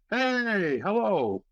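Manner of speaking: high, drawn-out hello; imitated delayed greeting. Man in wheelchair finally answering the visitor, high drawn-out greeting.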